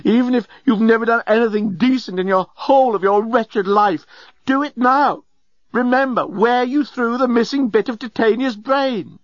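high, urgent, hectoring plea; impassioned appeal. A narrator's reading. Journalist appealing to the intoxicated old genius, impassioned cry. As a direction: drive every phrase home, pitched high. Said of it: "This appeal".

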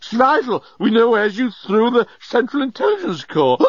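high, agitated insistence; urgent shouting. A narrator's reading. Journalist demanding the missing core from Leovinus, urgent shouting.

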